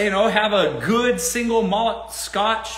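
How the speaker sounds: usual speaking voice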